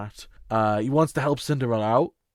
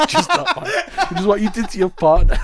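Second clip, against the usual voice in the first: laughing; 9 semitones higher, 9 dB louder than usual. Real, not acted. Teasing co-host about partner.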